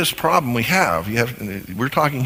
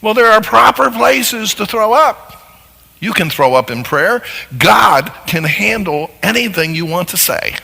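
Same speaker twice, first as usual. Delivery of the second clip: higher, louder pulpit preaching; full-force preaching; 8 semitones higher, 6 dB louder than usual. Acted. Preacher pressing the congregation on misuse, loud and emphatic. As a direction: drive it home from the pulpit.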